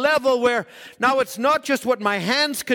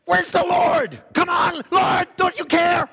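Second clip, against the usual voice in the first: higher, louder panicked orders; yelling disciples; 8 semitones higher, 3 dB louder than usual. Retold or imitated. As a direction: bark orders in a storm. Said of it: "they're yelling at one another".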